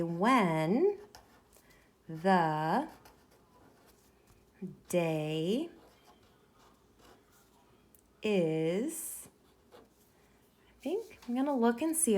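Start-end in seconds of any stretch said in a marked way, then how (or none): 0.0-9.3 each word drawn out slowly while writing; slow dictation. Shown as a demonstration. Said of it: "I'm gonna say".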